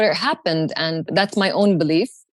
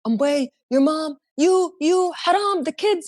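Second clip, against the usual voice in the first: panicked dad; 8 semitones higher than usual. Retold or imitated. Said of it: "he freaked out".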